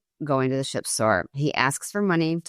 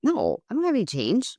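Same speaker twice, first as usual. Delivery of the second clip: higher, lightly voiced little boy; kid son's reply; 7 semitones higher than usual. Mom asks son about change, higher kid voice. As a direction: mimic a boy's easy shrug of an answer. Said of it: "he looked at me and he's like".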